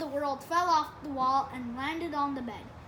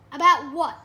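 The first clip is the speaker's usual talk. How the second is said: louder, curt challenge; sulky retort; 13 dB louder than usual. A narrator's reading. Sulking boy answers Dad through his door, loud and curt. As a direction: throw it back hard at the door.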